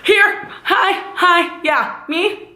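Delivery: high mock eager call, hi, hi; eager called-out reply. In pain, answering the nurse calling her name, high eager call. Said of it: "I'm like".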